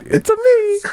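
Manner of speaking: very high cartoon Italian squeak; mock Italian accent. Friend copies the failed impression, mock Italian accent.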